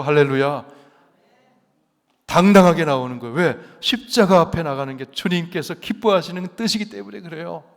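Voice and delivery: normal talking voice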